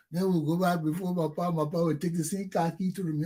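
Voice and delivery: usual speaking voice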